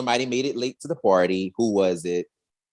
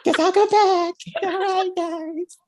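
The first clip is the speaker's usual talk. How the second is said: high, sheepish imitation of Amelia; mimicking Amelia. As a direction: retreat awkwardly in a high mock voice. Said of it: "She's like".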